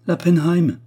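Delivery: high, loud indignant outburst; scandalised. A narrator's reading. Widow naming the woman she met, scandalised. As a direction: fling out the name in outrage.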